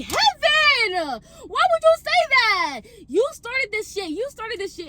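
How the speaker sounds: very high, drawn-out mock gasp of outrage; mock shock. Imitating shocked viewers reacting to the confession, mock shock.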